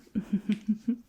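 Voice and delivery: high, breath-filled little titter; charmed giggle. Acted. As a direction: giggle at something cute. Charmed by the cute human ear.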